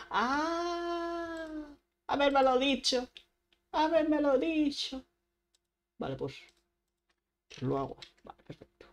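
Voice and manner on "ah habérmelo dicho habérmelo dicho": high, drawn-out whine; high-pitched pretend crying protest; mock wailing complaint. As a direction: wail it like a wronged child. Complains at finding out late.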